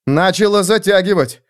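резкий выкрик на высокой ноте; вырвалось громче, чем хотелось. A narrator's reading. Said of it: "сказал Феликс громче, чем хотел". (громкий встревоженный возглас)

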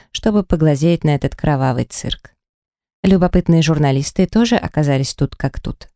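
plain reading voice

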